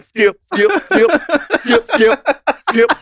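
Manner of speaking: lightly voiced Shannon Sharpe calling skip; hammered repeated skip with a laugh; Shannon Sharpe impression. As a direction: loose Shannon Sharpe bit. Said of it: "when it comes to Shannon Sharpe and me saying".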